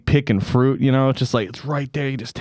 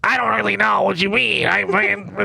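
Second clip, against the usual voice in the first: high, strained comic uncle voice building to a cry; hammed-up high shouting, cracking up; Uncle Emil; 8 semitones higher than usual. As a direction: ham up a ranting uncle, breaking into laughter. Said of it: "Uncle Emil's like".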